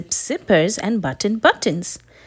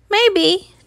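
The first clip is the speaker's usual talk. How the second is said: high chirpy bird voice; chirping bird; 10 semitones higher, 4 dB louder than usual. Acted. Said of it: "chirped the bird".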